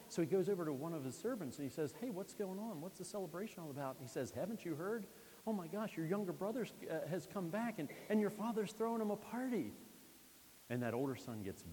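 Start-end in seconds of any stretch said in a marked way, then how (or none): 2.0-4.0 curious son. Acted. Older son asks a servant. 4.3-9.7 high, eager telling of the news; excited servant. Acted. Servant breathlessly telling the brother his news.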